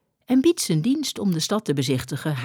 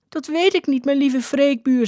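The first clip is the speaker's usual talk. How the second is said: high, helpless woman's answer; plaintive woman; 8 semitones higher than usual. A narrator's reading. Aagje to her neighbour the skipper, high plaintive voice.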